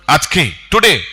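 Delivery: ordinary talk, nothing marked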